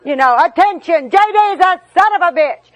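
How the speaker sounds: mock town crier shout; yelling to the neighborhood. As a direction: call it out across the backyard for all to hear. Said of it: "I'm yelling it out".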